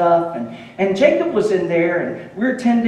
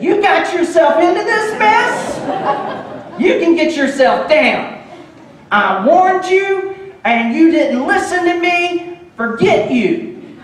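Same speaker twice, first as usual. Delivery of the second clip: high, loud, slow sarcastic telling-off; hammed-up high, loud scolding of a toddler stuck up top; mock scolding; 9 semitones higher, 4 dB louder, slower than usual. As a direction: pretend to leave him there in a huff. Mock scolding, then "I didn't do that.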